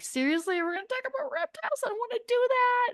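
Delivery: high, soft whine of disbelief; reluctant protest. Retold or imitated. Author balking at putting reptiles in her book, whiny protest.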